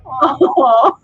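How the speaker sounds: mock-wounded aw; a small sad deflated aw; hurt little aw. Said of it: "my little heart will just be like".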